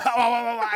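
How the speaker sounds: laughing mock panic; flustered babble. Rushing to dress wet, laughing flustered babble. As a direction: fumble the words like clothes snagging. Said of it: "あわあわして".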